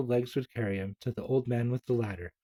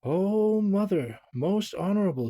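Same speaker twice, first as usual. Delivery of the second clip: panting; 8 semitones higher, 5 dB louder than usual. Acted. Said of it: "he panted".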